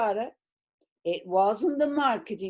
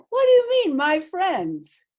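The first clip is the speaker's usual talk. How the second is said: outraged retort; 7 semitones higher, 6 dB louder than usual. Retold or imitated. Retells protesting to the president.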